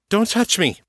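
sharp, high-pitched snap; snapped. A narrator's reading. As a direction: snap it out to ward someone off. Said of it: "Abby snapped".

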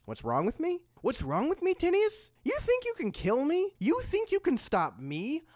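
rising high defiant questions; defiant rant. Acted.

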